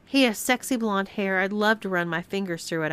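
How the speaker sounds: plain reading voice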